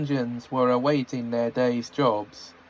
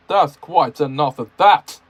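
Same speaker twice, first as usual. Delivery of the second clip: snapped; 2 semitones higher, 7 dB louder than usual. Acted. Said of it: "snapped the Fat Controller".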